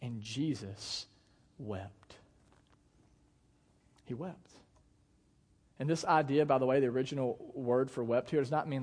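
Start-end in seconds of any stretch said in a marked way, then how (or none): 0.0-4.4 very soft and slow; quiet, grave scripture reading; hushed solemnity. A narrator's reading. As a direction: drop low and let each word land. Reads Jesus weeping, letting it land.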